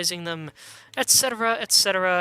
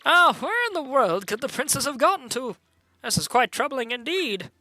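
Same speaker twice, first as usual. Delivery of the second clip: put-on high, wavering, old-fashioned worrier; fretful attendant; 8 semitones higher than usual. Attendant wonders where the princess is, high fretful voice. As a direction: fret aloud in a high, swooping voice.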